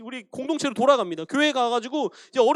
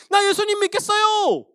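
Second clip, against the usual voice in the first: high, loud joyful cry of faith; excited declaration; 10 semitones higher, 6 dB louder than usual. Acted. Crowd member shouting to Peter, excited cry. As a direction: burst out with new belief.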